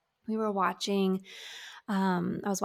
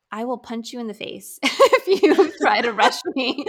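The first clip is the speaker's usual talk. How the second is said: loud, laughing joking threat; laughing mock threat; 3 semitones higher, 11 dB louder than usual. Real, not acted. Telling the host not to rush her grief, loud laughing mock threat. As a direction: threaten playfully through laughter.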